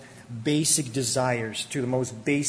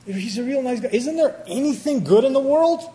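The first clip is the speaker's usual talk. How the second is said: incredulous question; 9 semitones higher, 4 dB louder than usual. Retold or imitated. Preacher voices his own astonished thought.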